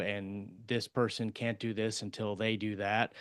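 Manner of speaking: normal talking voice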